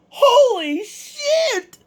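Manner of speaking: very high, drawn-out, slow astonished cry; amazed cry. Retold or imitated. Fighter hearing the crowd cheer for him, amazed. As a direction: stretch it out in disbelief.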